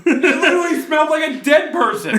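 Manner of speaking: shrieking with laughter, high and loud; real laughing outburst; laughing disgust. Real, not acted. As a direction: squeal it out through laughter. Disgusted at rotten potatoes.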